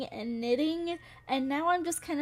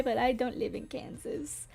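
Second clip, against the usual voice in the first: whisper. Real, not acted. Aside denying living in Kansas.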